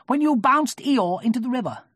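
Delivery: high, loud accusing voice; Rabbit. A narrator's reading. Rabbit accusing Tigger, high and important. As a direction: press the charge importantly.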